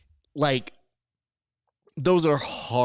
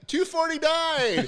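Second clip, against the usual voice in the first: very high, drawn-out call; slow high holler of the number; yelled price guess; 3 dB louder, slower than usual. Retold or imitated. Contestant passing on the crowd's price, high mock yell. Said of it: "when people were yelling it out".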